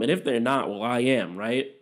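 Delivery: usual speaking voice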